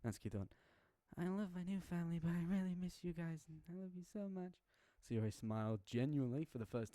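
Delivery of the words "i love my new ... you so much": put-on high, bright young child voice; little girl. Yuriki calling her old family, cheerful little girl. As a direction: play the small child on the phone.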